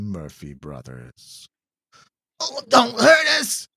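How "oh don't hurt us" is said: high, loud terrified plea; begging. Acted. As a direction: beg for mercy. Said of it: "Buck begs the beast".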